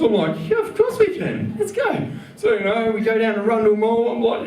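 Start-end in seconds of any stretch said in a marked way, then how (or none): 0.5-2.1 mock eager yes. His eager mock reply.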